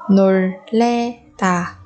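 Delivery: 놀래다 slowly, each syllable apart. Shown as a demonstration. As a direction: break 놀래다 into drawn-out syllables. Teacher models word syllable by syllable.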